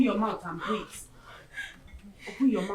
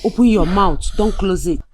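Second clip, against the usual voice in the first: louder, pressing order to push through; firm urging command; 9 dB louder than usual. Acted. Mother to daughter in labour, a loud firm command. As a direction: insist she keep her mouth open.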